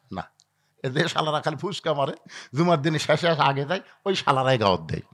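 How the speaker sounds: ordinary delivery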